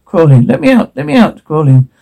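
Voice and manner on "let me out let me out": very high, thin-volume plea; desperate begging. Acted. Trapped character begs for release, desperate begging. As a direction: beg to be freed, pitch shooting up.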